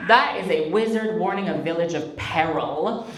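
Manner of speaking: ordinary talk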